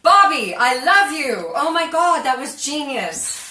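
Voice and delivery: loud high gushing thanks; ecstatic. Real, not acted. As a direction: shout with delight. Thanking viewer for tip.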